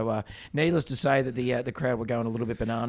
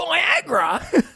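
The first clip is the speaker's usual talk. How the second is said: high, louder shocked Viagra?; imitating a colleague's startled realisation; mock surprise; 7 dB louder than usual. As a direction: blurt it out in astonished disbelief.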